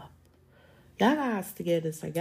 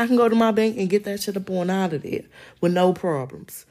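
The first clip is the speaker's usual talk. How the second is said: ordinary delivery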